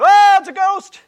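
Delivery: a high, loud panicked shriek; terrified cry. Retold or imitated. Disciples in the storm see Jesus on the water, shrieking in fear. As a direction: scream it out as if you've just seen a ghost. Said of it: "the disciples in the boat going".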